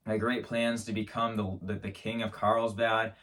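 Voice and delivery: ordinary talk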